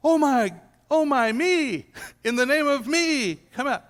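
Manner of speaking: mock dramatic exorcist. Mocking how Jesus did not cast out the demon, hammed-up. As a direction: overplay a showy exorcism. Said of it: "that Jesus didn't go".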